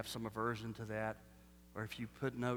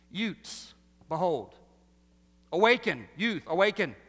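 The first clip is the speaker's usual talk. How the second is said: loud, higher mock-solemn proclamation; mock prophet call; 4 semitones higher, 10 dB louder than usual. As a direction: boom it like a hammed-up prophet calling out. Mock-grand summons to youth.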